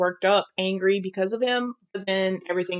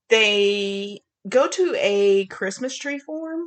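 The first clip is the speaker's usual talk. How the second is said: slow, drawn-out Southern "form" for farm; a Southern drawl slipping out on "form"; Southern twang leaking into "Christmas tree form"; slipped Southern accent; much slower than usual. Real, not acted. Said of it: "What was that accent?".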